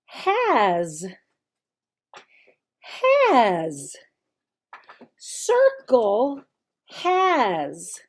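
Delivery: slow, drawn-out drill of the word has; slow teaching drill. Shown as a demonstration. Teacher drilling a child, slow and exaggerated. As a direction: model the word has slowly for young learners. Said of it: "this is how you would start teaching".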